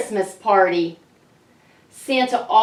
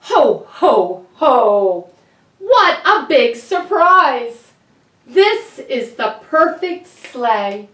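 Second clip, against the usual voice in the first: loud, booming Santa, slow on the surprise; jolly Santa voice; 3 semitones higher, 6 dB louder, slower than usual. Shown as a demonstration. Reader shows the children Santa's jolly voice, booming and slow. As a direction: boom like a delighted Santa. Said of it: "Can you guys say that?".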